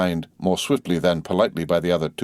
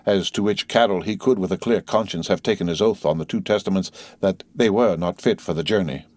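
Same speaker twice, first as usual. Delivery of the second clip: ordinary delivery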